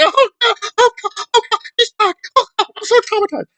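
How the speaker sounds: very high, fast mock-panicked wail; mock traumatized woman. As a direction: ham up fake trauma. Mocking woman claiming trauma.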